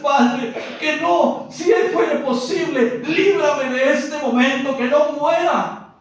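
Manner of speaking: loud, high desperate plea to the Father; pleading Jesus. Acted. Jesus in Gethsemane begs Father, loud high pleading. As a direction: beg the Father not to die.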